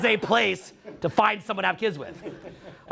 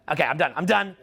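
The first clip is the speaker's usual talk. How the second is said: ordinary talk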